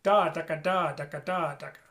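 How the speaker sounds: "ka" brushed back against the ridge; da ka tonguing. Shown as a demonstration. Teacher demonstrating tonguing to students, back "ka". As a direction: chant it with a back "ka". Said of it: "And the ka kinda comes back".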